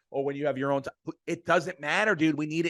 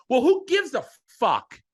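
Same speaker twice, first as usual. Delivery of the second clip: high, louder fed-up scoff; exasperated outburst; 7 semitones higher, 4 dB louder than usual. Real, not acted. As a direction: wave off the excuses with disgust. Dismissing the Yankees' excuses.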